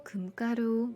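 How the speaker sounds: usual speaking voice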